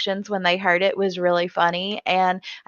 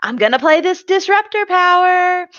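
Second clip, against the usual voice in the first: higher, louder gloating boast; imitating David; 8 semitones higher, 4 dB louder than usual. David announcing his disruptor power, mock gloating. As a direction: crow it like a smug reveal. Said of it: "we get David saying".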